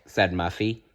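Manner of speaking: ordinary delivery, nothing marked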